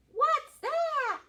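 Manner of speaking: very high and loud; animals asking. Acted. Animals asking the dingo what's missing, very high. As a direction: squeak it out in surprise. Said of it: "asked all of the animals".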